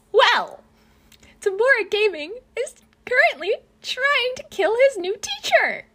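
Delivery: high-pitched, frantic narrator; alarmed reveal. A narrator's reading. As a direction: build to a loud, high, incredulous announcement. Comic build-up to alarming reveal.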